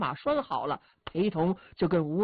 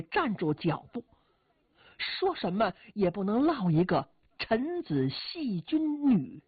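usual speaking voice

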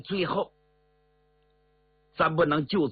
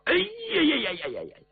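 a long wail that starts much higher; despairing wail; 13 semitones higher than usual. Acted. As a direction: cry out in dismay as everyone stamps their feet. 众人绝望跺脚. (众人跺脚绝望地拉长声哀叫)

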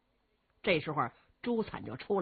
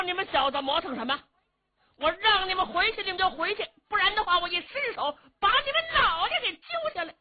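much higher, loud hot-tempered shouting; angry threat; 12 semitones higher, 4 dB louder than usual. Acted. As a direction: threaten to rip their heads off, building up. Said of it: "朱灿急了".

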